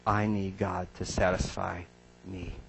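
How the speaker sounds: usual speaking voice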